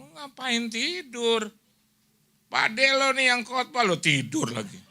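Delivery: high-pitched, drawn-out mock reproach; retold stern scolding. Uncle tells off sleeping relative in the car, retold stern scolding. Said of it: "Saya keras kalau buat itu, tegas".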